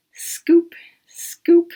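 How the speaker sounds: breathy whispered scoop, scoop. Shown as a demonstration. Parent showing the child how to scoop, whispering. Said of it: "Can you scoop out the pulp".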